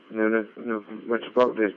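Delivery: normal talking voice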